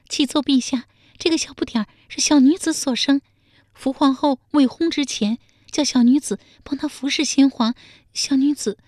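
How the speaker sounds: a timid maid faltering before the throne; stammering palace maid. A narrator's reading. Palace maid answering the emperor, high and timid. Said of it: "宫女结结巴巴地说".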